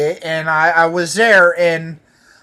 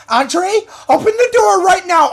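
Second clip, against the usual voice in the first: much higher, louder mock pounding-cop shout; high shrieking imitation of an officer demanding entry; 13 semitones higher, 4 dB louder than usual. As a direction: yell through the door like a furious cop. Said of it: "She's like".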